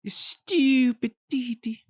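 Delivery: high, drawn-out cartoon scolding; cartoon Dexter impression. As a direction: put on a high, slow cartoon voice. Mimics cartoon Dexter mid-discussion.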